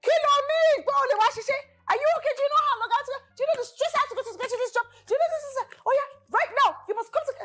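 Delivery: imitating the strict auntie tearing into her; scolding Auntie Bimbo. [name] voicing Auntie Bimbo scolding her, high mock rant. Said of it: "She said".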